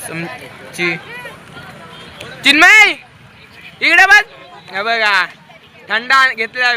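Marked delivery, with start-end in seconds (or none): 2.4-4.2 calling out. Real, not acted. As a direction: call his name loudly and higher. Calling Chinmay to look.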